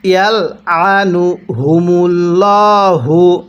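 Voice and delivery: slow, drawn-out tajweed chanting; slow Quran recitation. Shown as a demonstration. Teacher demonstrating recitation to students, slow chant. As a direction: recite each syllable long and measured. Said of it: "কিভাবে শিখব?".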